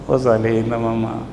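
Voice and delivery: soft, low aside to his mother; tender aside. Real, not acted. Preacher aside to his dear mother, soft and tender.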